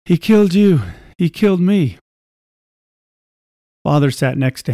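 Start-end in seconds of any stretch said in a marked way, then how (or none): 0.0-2.0 higher, breaking sobs; sobbing. Acted. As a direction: cry the words out. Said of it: "Sobs boiled out of Henry".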